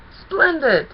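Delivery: high, delighted trickster cry; gleeful Discord. A narrator's reading. Discord gloats as the pony signs the contract, delighted.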